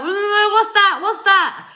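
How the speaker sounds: high, loud mock confusion; baffled reaction. Reader mimicking a confused viewer, high and loud. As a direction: play the lost viewer squinting at it. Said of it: "I'm like".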